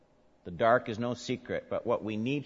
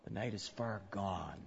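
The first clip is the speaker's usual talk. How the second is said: hushed; 2 semitones lower, 12 dB quieter than usual. Acted. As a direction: let "the night is far gone" sink quietly. Hushed opening line.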